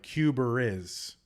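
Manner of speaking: CUBA IS with an R slipped between, "Cuber is"; linking R. Shown as a demonstration. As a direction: say Cuba like "Cuber" before "is", drawn out. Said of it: "they will put an R in between them".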